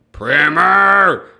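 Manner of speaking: loud put-on-voice shout; Charmel yell. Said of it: "If you do it in Charmel's voice, you have to yell it".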